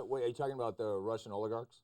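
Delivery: normal talking voice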